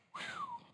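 soft, high whistle of amazement; high whistled "whew". Shown as a demonstration. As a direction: let out a quiet impressed whistle. Reacting to the great power.

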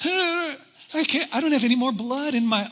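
high gasp, straining to pull up; breathless strain as the grip fails. Retold or imitated. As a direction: strain with no strength left in the arms. Straining, gasping while hanging.

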